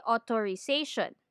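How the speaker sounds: "authorization" with t for th, o-to-ri; Filipino mispronunciation. Shown as a demonstration. Teacher lists commonly mispronounced words, Filipino-style "authorization" with t. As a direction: say "authorization" as "otorization". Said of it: "We say".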